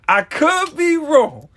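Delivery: slow, high, laughing through "I could be wrong"; laughing again. Real, not acted. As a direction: keep laughing as you repeat it. Laughing at his own claim.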